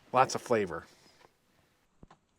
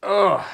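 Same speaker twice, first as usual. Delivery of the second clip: high "ugh" at strong fumes; real high-pitched recoil; reeling groan; 7 semitones higher, 4 dB louder than usual. Real, not acted. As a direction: groan as if onion fumes hit you.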